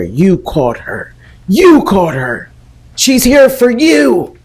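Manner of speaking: hammed-up witch accusing her; mild mock yell. Old witch hissing accusations into her ear, mock yelling. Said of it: "As she's yelling".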